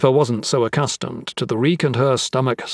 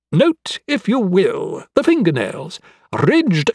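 raised pitch, precise and keen; eccentric, lecturing anatomist; 4 semitones higher than usual. Acted. Anatomist showing a corpse's fingernails, keenly lecturing. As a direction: list each clue with scholarly relish.

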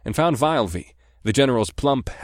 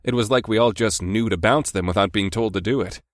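ordinary delivery

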